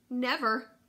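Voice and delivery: loud, high emphatic never; firm never. Acted. Mother answers Sophie, loud and firm. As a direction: slam the door on it.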